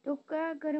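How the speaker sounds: normal talking voice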